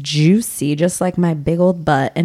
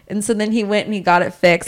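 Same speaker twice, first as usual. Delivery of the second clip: usual speaking voice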